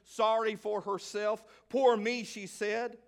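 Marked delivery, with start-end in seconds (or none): 1.7-2.2 a whiny, sing-song poor me, a little higher and louder; hammed-up self-pity moan; mock self-pity. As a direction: pout it like a martyr. Woman voiced complaining to herself.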